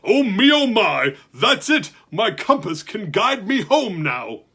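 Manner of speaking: loud, high, thrilled giant voice; a giant bursting with joy; excited giant. Acted. Giant overjoyed.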